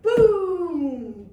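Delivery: loud booming thunder. Shown as a demonstration. Teacher leads kids in loud thunder booms. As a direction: drop a big boom with the arm. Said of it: "let's start with our thunder".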